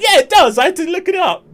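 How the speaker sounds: very high, loud mock sister voice; imitated outburst. Imitating sister admitting she looked it up, high mock outburst. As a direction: exclaim it high and loud, laughing at the end. Acts out sister's indignant reply.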